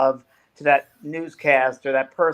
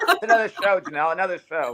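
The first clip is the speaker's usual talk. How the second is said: laughing tease; 5 semitones higher than usual. Real, not acted. Teasing co-host with laughter.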